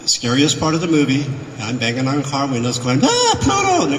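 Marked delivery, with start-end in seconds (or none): 3.0-3.8 very high monster-on-the-loose shriek; playacted scary yell; mock scare cry. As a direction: jump out and scare them, high-pitched. Acting scary at car windows.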